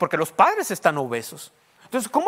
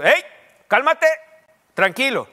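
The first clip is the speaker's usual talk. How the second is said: louder, higher call to calm down; raised voice; 7 semitones higher, 7 dB louder, slower than usual. Retold or imitated. Father raising his voice to calm his kids. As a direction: firmly raise the voice on cálmate. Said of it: "subir un poquito la voz".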